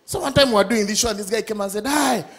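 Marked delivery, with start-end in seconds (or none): none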